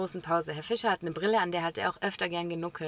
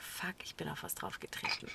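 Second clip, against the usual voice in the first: whispered, much softer, caught out; 4 semitones lower, 14 dB quieter than usual. Retold or imitated. Stepping on the teacher's glasses, whispered in shock. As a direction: breathe it in horrified realization.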